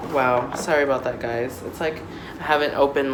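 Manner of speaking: ordinary talk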